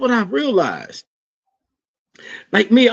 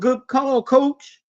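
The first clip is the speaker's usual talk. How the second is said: mock parent cheer; 5 semitones higher, slower than usual. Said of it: "when you yelled".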